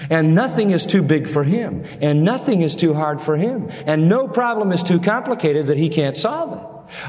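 rising pulpit fervor; building preaching climax. Acted. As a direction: climb to a ringing declaration of faith.

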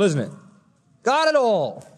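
ordinary talk

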